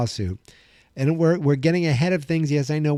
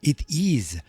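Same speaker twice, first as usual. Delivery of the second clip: host welcoming listeners as a campy Transylvanian count; vampire accent. Pretend. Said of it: "with a very stereotypical European accent used for vampires".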